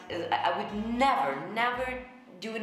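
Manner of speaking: normal talking voice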